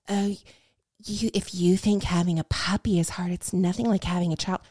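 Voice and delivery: soft, whispery, patronizing imitation; condescending. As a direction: belittle them in a hushed superior voice. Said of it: "there's nothing more condescending".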